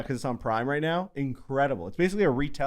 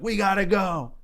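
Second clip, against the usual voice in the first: lightly acted urgent warning, pitched up; higher-pitched, hurried-sounding mock alarm; mock urgent call; 7 semitones higher, slower than usual. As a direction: imitate a comrade urging a retreat. Acts comrade's urgent warning in battle.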